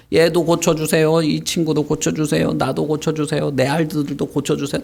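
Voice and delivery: acted villagers begging for help; pleading crowd. Voicing crowd begging for healing.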